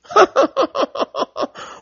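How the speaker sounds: sharp high laugh of disbelief; high scornful laugh. Real, not acted. Ranting vlogger laughs at drilling for oil, high and scornful.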